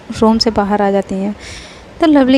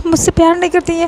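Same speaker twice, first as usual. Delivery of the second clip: angry; 6 semitones higher, faster than usual. A narrator's reading. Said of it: "Faiz भी गुस्से में कहती".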